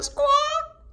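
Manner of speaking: high, sudden bird squawk. A narrator's reading. Bird stuck under her feathers, high squawk. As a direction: let out a shrill little squawk.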